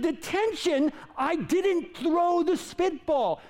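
high, slow outraged protest; indignant kid. Retold or imitated. Accused student protesting detention to the teacher, high and outraged. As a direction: plead the unfairness high and drawn out.

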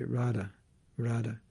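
"Radha" said short, quick and low; short-syllable mispronunciation. Shown as a demonstration. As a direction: show the careless short "Radha". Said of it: "Like some devotees say".

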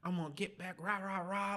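high-pitched retold rant; worked-up retelling of being livid; pissed. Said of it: "I'm pissed".

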